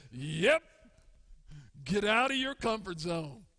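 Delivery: God gently pushing him out; God answering. Acted. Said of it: "And God says".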